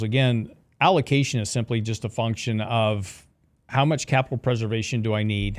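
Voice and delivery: ordinary talk, nothing marked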